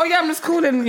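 high-pitched, chirpy phone opener; casual cold caller. Retold or imitated. New cold caller expecting to be expected, mock casual.

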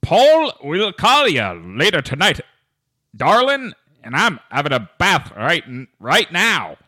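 high, loud Scottish-Texan lilt with a soaring darling; put-on Scottish-Texas accent; Scottish-Texas mix accent. Shown as a demonstration. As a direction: ham up a Scottish and Texas blend. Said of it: "not in the same way I did".

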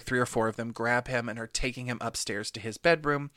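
ordinary delivery

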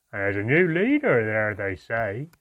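loud dwarf voice passing on rumour; loud, rustic dwarf gossip; second dwarf. A narrator's reading. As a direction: chime in loudly as a dwarf.

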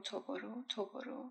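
very soft, fading repetition; haunting, barely voiced refrain; hushed echo. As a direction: let the repeated words drop to a murmur. Echoing voice in poem.

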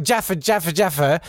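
high, chanted mock pestering; mock clamouring. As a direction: imitate someone clamouring, pitched well up. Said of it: "you're like".

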